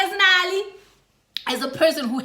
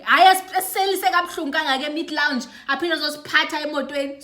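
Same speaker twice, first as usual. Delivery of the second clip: loud and high, quick; loud, fast, fuming complaint; outraged rant; 4 semitones higher, 5 dB louder, faster than usual. Retold or imitated. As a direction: blast it out in indignation. Outraged rant to her friend.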